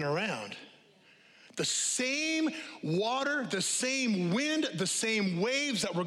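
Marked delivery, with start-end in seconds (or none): 1.6-4.7 high, slow, every word stretched out; slow preaching emphasis. Real, not acted. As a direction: lean on "same" each time, drawn out. Preacher hammers the point.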